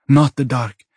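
firm, high-voiced protest; immediate objection. A narrator's reading. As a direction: object at once, pitch and volume up. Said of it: "she says immediately".

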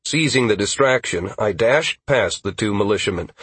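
ordinary delivery, nothing marked

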